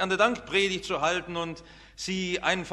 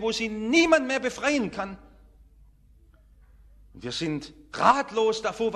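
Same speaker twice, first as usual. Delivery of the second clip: normal talking voice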